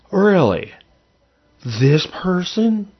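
high, drawn-out disbelieving questions; incredulous disbelief. Retold or imitated. Host recalling his young self judging successful people, incredulous. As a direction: stretch each question upward in disbelief.

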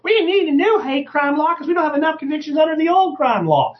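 high, whiny monotone mockery; mock bitch accent. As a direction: caricature a nagging politician up high. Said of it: "I'm just doing a bitch accent".

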